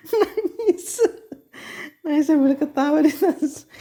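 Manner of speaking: high-pitched words through real laughter; laughing hard. Real, not acted. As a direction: laugh helplessly while you talk. Geli melihat mama nangis sambil ketawa. (bicara sambil tertawa geli)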